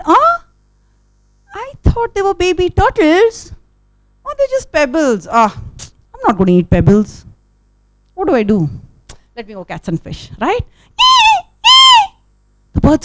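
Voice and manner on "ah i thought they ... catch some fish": higher, lightly voiced bird grumbling; a hungry bird let down by pebbles; disappointed bird. Storyteller voicing the eagle who finds only pebbles, higher pitched and grumbling. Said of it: "the bird looked down and said".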